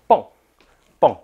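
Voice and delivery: short loud burst like a firecracker; loud bang call. Shown as a demonstration. Teacher shows kids the firecracker call, loud. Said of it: "大声的说".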